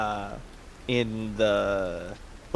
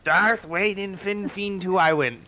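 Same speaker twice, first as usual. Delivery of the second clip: higher, slower copy of "Fenfing"; imitating the sailor; 6 semitones higher, slower than usual. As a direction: parrot the sailor's strange words back. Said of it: "He looks at you, confused".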